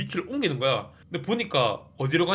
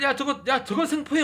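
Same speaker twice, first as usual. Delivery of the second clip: excited order; 9 semitones higher than usual. Retold or imitated. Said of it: "막 이러는 거야".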